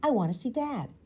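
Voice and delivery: loud insistent demand; a girl loudly insisting on seeing Dad. A narrator's reading. Rue demanding to see Dad.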